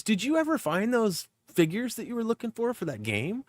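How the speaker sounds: high mother-in-law voice. Retold or imitated. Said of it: "She goes".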